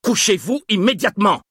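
very high shouted order; shouted command. A narrator's reading. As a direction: scream the order at him. Guard repeats order at gunpoint.

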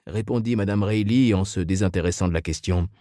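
ordinary delivery

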